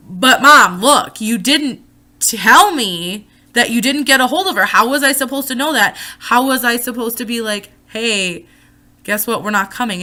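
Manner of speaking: loud, heated yelling at mom; angry shouting. Retold or imitated. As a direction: shout it out in frustration. Heated argument with mom retold.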